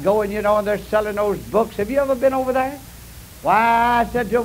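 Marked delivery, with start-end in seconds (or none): 1.8-2.8 fast aside. Real, not acted. Quick aside to audience.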